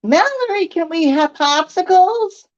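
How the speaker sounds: put-on little boy calling to Mallory for popsicles; child calling out. A narrator's reading. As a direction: call out like a small boy asking a favor. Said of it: "calls through the screen of the open door window".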